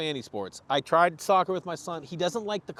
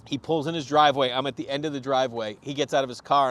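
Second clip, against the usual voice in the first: ordinary delivery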